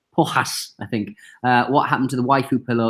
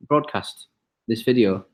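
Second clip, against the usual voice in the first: normal talking voice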